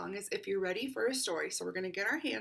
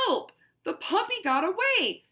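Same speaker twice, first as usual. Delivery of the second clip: higher, louder cry for help; panicked; 9 semitones higher, 11 dB louder than usual. Acted. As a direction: sound alarmed. Cat panics.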